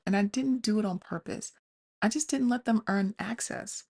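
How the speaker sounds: ordinary talk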